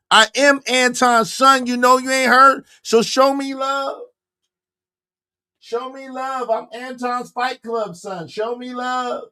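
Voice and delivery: high, hyped bragging. Real, not acted. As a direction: hype the chat up, pitch up high. High hype bragging at the chat.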